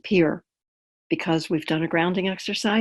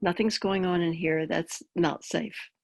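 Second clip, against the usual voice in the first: calm grounding exercise; 5 dB quieter than usual. Acted. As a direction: guide them gently into the body.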